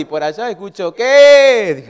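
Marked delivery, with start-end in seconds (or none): 0.9-1.7 very loud, high yelled reply; imitated shout back. Wife answering her yelling husband from over there, mock shout. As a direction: holler back as the interrupted wife.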